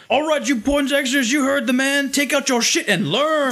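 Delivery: imitating Jamal taking charge; tough Jamal. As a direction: boss the class around. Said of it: "he steps in and he goes".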